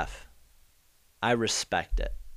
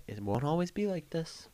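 mock wise saying, higher; 5 semitones higher, 8 dB quieter than usual. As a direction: say it like a hopeful reassurance, pitched up. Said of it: "And I'ma say".

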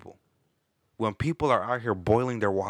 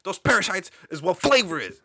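loud mock rant; swearing tirade; 9 semitones higher, 6 dB louder than usual. Comic rant about boiled water.

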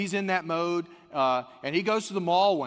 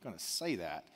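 muttered refusal; 8 semitones lower, 9 dB quieter than usual. Retold or imitated. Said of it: "he kinda goes".

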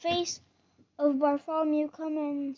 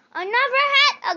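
high, loud shocked cry; 11 semitones higher, 12 dB louder than usual. A narrator's reading. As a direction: cry out in disbelief.

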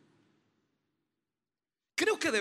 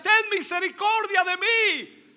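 crying out for mercy; 9 semitones higher, 8 dB louder than usual. Retold or imitated. The woman shouting to Jesus, loud begging cry. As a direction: beg at the top of the voice. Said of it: "Por eso ella gritaba y decía".

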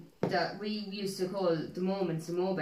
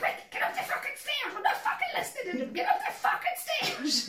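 very high squealing mum impression; hammed-up panic, laughing through it; panicked mum. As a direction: mimic Mum frantically ordering the kids away. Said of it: "She'd be like".

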